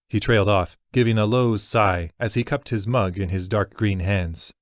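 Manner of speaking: plain reading voice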